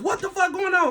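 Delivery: mock alarm, voice jumped right up; high-pitched outburst. Speaker imagines tripping out over being high, high-pitched outburst. Imagined panicked reaction, acted out.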